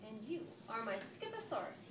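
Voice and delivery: ordinary delivery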